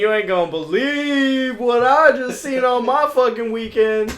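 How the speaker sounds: high, loud mock announcement; hammed-up big news to share; eager gossip. Friend acts out telling people at work, high excited gossip.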